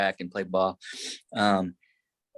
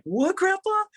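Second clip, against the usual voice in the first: high-pitched, incredulous acted reply; astonished; slower than usual. Young man stunned by grandpa's offer to pay, astonished. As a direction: retell it as a squeaky burst of disbelief.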